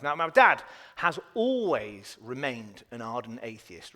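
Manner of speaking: usual speaking voice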